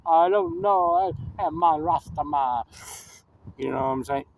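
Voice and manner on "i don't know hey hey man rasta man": slow, drawn-out mock Jamaican accent; badly faked Jamaican Rasta. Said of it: "that's my Jamaican fucking Italian accent".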